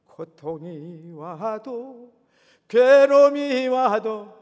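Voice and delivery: drawn-out pleading prayer over music; impassioned prayer. Acted. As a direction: pour out the prayer at full force. Fervent sung-out prayer over music.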